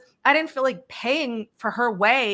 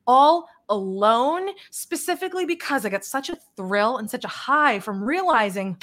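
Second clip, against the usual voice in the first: ordinary talk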